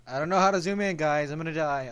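fast, loud, high stammered wail; real panic. Real, not acted. Gamer tells viewers he cannot zoom, fast panic.

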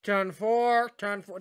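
mock radio call, starting high and loud; imitating radio voice. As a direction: call it out like someone on a crackly radio. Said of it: "like somebody on a radio going".

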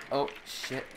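ordinary talk, nothing marked

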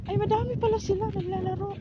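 very high, excited voice; high, sustained excited exclamation; delighted discovery. Real, not acted. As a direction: marvel in a high-pitched squeal. Reacting to many birds playing.

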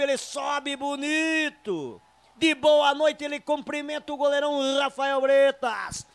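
shouted, stretched goal call; explosive goal commentary. Real, not acted. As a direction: erupt into the goal call, drawing out every word.

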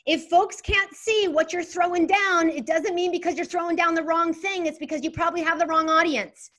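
fired up. Real, not acted. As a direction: drive the point home with energy. Fired-up motivational speech.

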